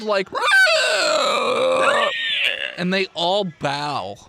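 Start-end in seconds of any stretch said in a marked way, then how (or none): 0.3-2.7 high screaming pig shriek; bloodcurdling pig squeal. Retold or imitated. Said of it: "lets out this, like, blood-curdled".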